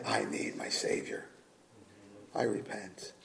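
hushed, breathy whisper of a sinner confessing; a sinner's whispered plea to his Savior; whispered repentance. Acted. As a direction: whisper it like a secret prayer. Sinner's quoted prayer of repentance.

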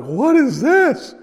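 high, slow, puzzled "what is this"; mock bewildered classmate; baffled classmate. Classmates confused by gospel tracts.

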